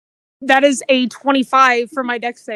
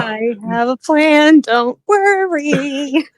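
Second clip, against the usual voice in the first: slow, stretched-out mock reassurance; drawn-out reassurance; much slower than usual. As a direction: stretch the words out, especially the last. Playfully echoes own earlier assurance.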